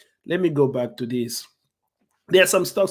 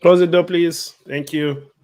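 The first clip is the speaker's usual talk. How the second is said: raised, firm call across the room; 4 semitones higher, 8 dB louder than usual. Real, not acted. Parent interrupting a live recording to call out, louder.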